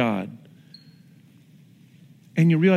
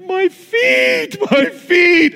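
high-pitched wail imitating a crying son; hammed-up kid's cry, much higher; mock crying child; 13 semitones higher, 6 dB louder, much slower than usual. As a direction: play up a little boy's tearful complaint. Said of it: "and he's crying".

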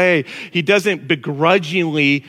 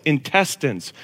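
ordinary talk, nothing marked

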